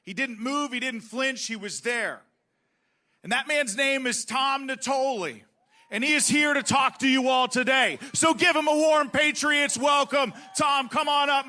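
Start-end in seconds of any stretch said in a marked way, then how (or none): 3.2-11.4 loud, rising crowd-hype announcement; rally shout. Real, not acted. Host hyping the rally crowd for the guest, loud. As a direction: pump up the crowd as you bring him on.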